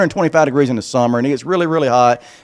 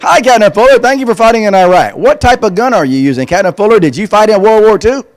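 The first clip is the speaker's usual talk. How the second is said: put-on higher, louder excited kid asking questions; eager kid's voice; 7 semitones higher, 5 dB louder than usual. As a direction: pepper him with questions like a fan. Reading child's questions.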